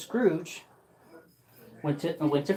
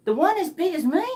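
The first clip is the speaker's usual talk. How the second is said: put-on high boy voice; 11 semitones higher, 4 dB louder, faster than usual. Boy asks Scrooge.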